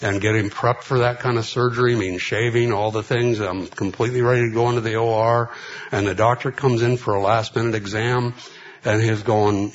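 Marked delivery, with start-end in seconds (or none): none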